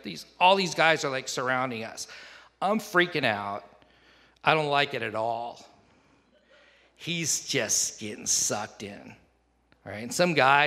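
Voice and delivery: normal talking voice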